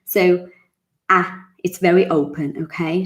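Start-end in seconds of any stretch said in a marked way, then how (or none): none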